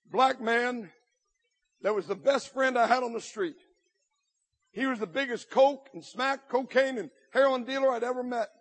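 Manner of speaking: ordinary talk, nothing marked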